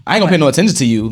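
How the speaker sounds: put-on teen attitude. Voicing teen daughter.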